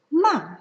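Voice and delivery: "ma" as a short, loud downward command; falling fourth tone. Shown as a demonstration. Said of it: "is a downward command tone".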